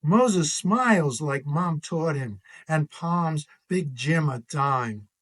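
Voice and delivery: ordinary delivery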